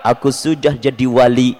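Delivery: low, slow self-satisfied boast; mock pride. Preacher imitates someone proud of being a saint, mock pride. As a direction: puff yourself up, slowly and low. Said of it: "lalu berbangga".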